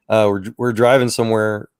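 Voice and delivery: usual speaking voice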